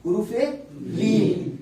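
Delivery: حروف لین spelled out very slowly; slow, drawn out. Shown as a demonstration. Teacher naming the term slowly for students. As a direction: lay out each sound of حروف لین. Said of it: "انہیں کہتے ہیں ہم حروف لین".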